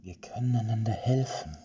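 soft, seductive whispering voice in his head; whispered. Acted. Witch's voice whispers inside Erik's head, seductively soft. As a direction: slowly whisper his longings to lure him. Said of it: "flüsterte die Stimme in seinem Kopf".

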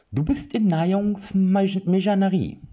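squeaky little creature with a lisp; lisping high voice. Acted. Tiny Upkolupke answering the captive, high lisping. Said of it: "eine hohe Stimme mit einem dezenten Problem".

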